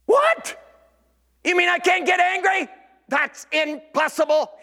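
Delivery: sharp high "What?", then indignant disbelief; incredulous objection. Acted. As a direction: play a startled churchgoer protesting. Congregant objects to Jesus' teaching.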